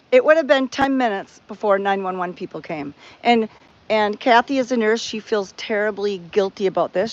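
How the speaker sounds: normal talking voice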